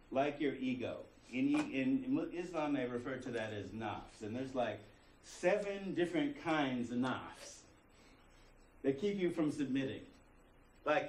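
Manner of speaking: ordinary talk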